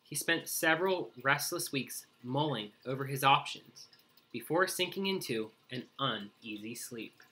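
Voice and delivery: ordinary delivery, nothing marked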